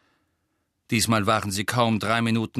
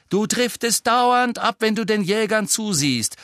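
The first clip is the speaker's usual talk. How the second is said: raised-voice captain's telling-off; scolding; 11 semitones higher, 5 dB louder than usual. A narrator's reading. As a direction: call out the criticism. Captain scolds Ron across pitch.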